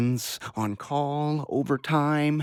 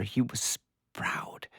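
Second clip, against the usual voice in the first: slow, breaking into a whisper; the grief takes his voice away; whispered, barely holding it together; choked whisper; 8 dB quieter, slower than usual. Acted. Nurse grieving lost patient.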